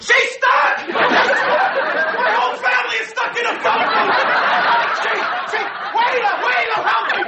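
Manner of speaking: very high, frantic yelling; panicked shouting. Acted. Husband with family stuck in phone booth, panicked shouting. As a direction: burst into a screaming plea for help. Breaks into panic, yells for help.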